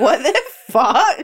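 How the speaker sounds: slow, stretched mock outrage; mock shocked voice. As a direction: imitate a friend's stunned reaction. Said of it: "Is that my voice?".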